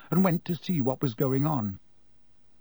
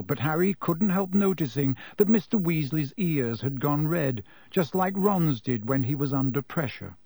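usual speaking voice

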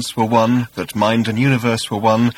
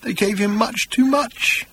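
very high, slow whimper; pitiful high whine, each word drawn out; tiny whimper; 10 semitones higher, slower than usual. A narrator's reading. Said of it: "he said in a tiny whimper".

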